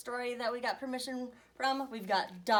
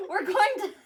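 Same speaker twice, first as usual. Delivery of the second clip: laughing; 11 semitones higher, 5 dB louder than usual. Real, not acted. Reader laughs at own slip.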